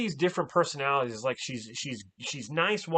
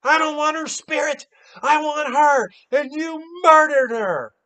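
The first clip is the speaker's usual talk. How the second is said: high, loud angry accusation; imitated furious accusation; 12 semitones higher, 10 dB louder than usual. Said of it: "she gets mad at it".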